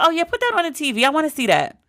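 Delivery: imitating her mother, now keen to watch the show; eager mom. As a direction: mom won over, hurry put it on.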